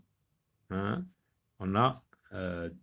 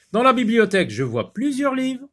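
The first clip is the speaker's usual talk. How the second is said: higher, louder expressive read; reading aloud; 12 semitones higher, 7 dB louder than usual. Shown as a demonstration. Teacher reading the example to the class, expressive. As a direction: present the example sentence with energy.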